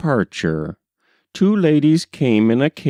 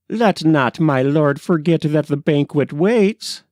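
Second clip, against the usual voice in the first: lightly raised, mock-courtly bow of a voice; droll mock-formal boy; 6 semitones higher than usual. A narrator's reading. Office boy teasing his new colleague, droll and mock-formal. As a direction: play the cheeky office boy mocking grandeur. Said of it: "said this precocious youth with a droll obeisance".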